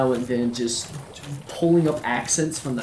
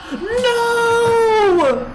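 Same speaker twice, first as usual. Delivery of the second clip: much higher, loud despairing "No!"; anguished shout; 5 dB louder than usual. Real, not acted. Gamer shouting in despair as his character dies. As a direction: cry out as the run ends.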